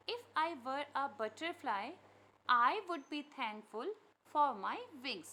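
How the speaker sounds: slow, deliberate line-by-line poem reading; poem recitation. Acted. Teacher reading a poem aloud to pupils, slow recitation. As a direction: recite it loudly and very slowly, word by word.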